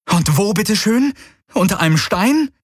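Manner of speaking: high, exasperated questions; incredulous sarcasm. Acted. Companion sees only open grassland, sarcastically incredulous. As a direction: throw it back in disbelief.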